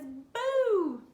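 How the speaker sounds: sudden loud high boo; spooky boo. Acted. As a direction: pop out and startle the kids. Spider in flap jumps out.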